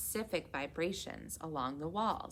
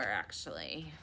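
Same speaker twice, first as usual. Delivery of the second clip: usual speaking voice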